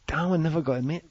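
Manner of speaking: ordinary talk, nothing marked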